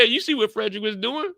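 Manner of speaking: normal talking voice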